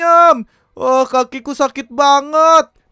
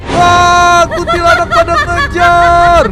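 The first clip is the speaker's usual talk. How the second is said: scared shout; 3 semitones higher, 5 dB louder, slower than usual. Acted. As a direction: yell out in fright, running for your life. Ghost chasing the group. (teriakan ketakutan yang keras dan tinggi)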